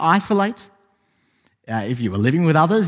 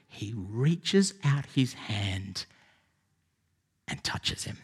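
hushed; 5 semitones lower, 10 dB quieter, much slower than usual. Acted. Dramatic moment of Jesus touching.

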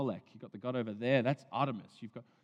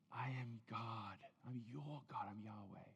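softer, slow, whispered God; tender whisper; 10 dB quieter than usual. Shown as a demonstration. Preacher voicing God tenderly to the listener, whispered. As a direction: whisper it tenderly, barely voiced. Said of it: "God speaks tenderly to you".

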